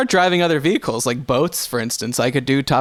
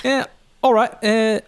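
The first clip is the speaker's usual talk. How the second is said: put-on high, hammy Cockney accent; a jokey British accent, high-pitched; Vin Diesel British accent; 7 semitones higher than usual. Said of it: "your best Vin Diesel doing a British accent".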